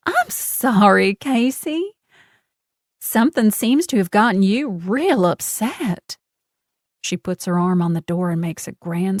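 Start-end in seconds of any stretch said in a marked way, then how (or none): 0.1-6.2 slow, higher syrupy false sympathy; sugary mock concern. A narrator's reading. Genevieve mocking Casey's anger with sweet concern. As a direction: patronize her with sweetness.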